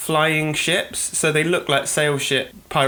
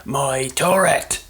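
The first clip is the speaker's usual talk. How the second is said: slow, drawn-out dwarf impression; dwarf game character; much slower than usual. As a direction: put on a dwarf's voice, stretching both words. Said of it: "a sort of dwarf that's got this turret".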